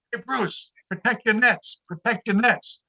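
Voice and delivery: high-pitched coach call, no real volume; mock teacher yell. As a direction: imitate a teacher calling across the pool. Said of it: "the teacher would be yelling".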